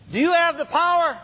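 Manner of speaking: high, loud call to the crowd; rallying shout. Real, not acted. Speaker firing up chiropractors in the hall, with a rallying shout. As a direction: fire up the room with the question. Calling out to the audience.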